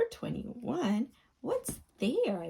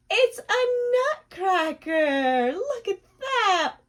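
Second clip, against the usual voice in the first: thrilled; 7 semitones higher, 9 dB louder, slower than usual. Acted. Opening a door, thrilled.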